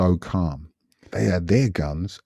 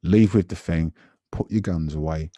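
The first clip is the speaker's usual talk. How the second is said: normal talking voice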